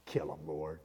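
low, soft, spiteful aside; Jonah's grudge. Retold or imitated. Jonah mutters to God about the Ninevites, low and spiteful. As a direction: sneer it under your breath. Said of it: "no love in his heart for the Ninevites".

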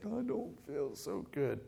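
high, soft and slow whimper; sick kid voice. Retold or imitated. A dad imitating his child sick from chips. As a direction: sigh it out like a queasy child.

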